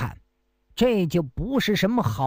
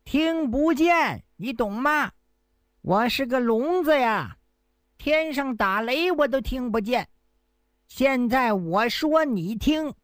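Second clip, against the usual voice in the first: higher, overacted deaf man explaining himself; pretending to be deaf; 5 semitones higher than usual. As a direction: play a fake deaf man making a show of it. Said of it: "假装恍然大悟".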